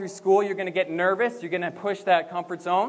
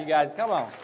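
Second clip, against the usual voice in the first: ordinary talk, nothing marked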